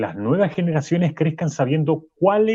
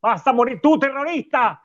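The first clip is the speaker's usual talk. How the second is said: outraged accusation; 7 semitones higher than usual. Acted. Professor rages at caller. (acusación indignada)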